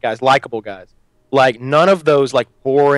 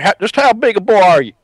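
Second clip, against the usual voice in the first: Roy D. Mercer; 6 semitones higher, 4 dB louder than usual. Acted. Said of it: "redneck accent".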